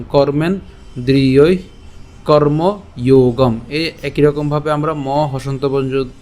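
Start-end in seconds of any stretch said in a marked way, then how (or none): 0.0-3.5 kormen-driyoi, karma-yogam in drawn-out parts; kormendriyoi, karmayogam slowly modelled. Shown as a demonstration. Teacher models the verse, kormendriyoi karmayogam slowly split. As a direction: stretch the vowels of kormendriyoi and karmayogam. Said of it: "উচ্চারণ করলাম".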